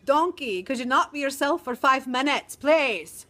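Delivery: loud, slow, exasperated Shrek; a hammed-up Shrek pleading with Donkey. Teacher quoting Shrek scolding Donkey, loud and exasperated. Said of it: "I feel like Shrek".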